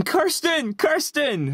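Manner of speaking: high calling out a name; high-pitched call for a cousin; retold shouting. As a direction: call her name up high across the house.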